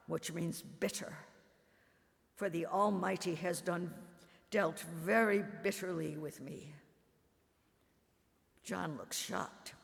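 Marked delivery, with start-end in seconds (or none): none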